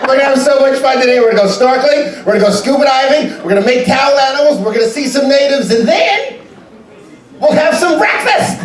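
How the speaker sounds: way up high, bouncy wake-up call; overeager cruise director. Acted. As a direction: chirp it like a morning cheerleader. Cruise director waking passengers up.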